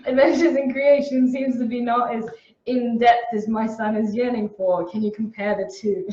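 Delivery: amused go at an Australian accent; attempted Australian accent. Shown as a demonstration. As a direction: read it out in a put-on Australian accent. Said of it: "read this next one in an Australian accent".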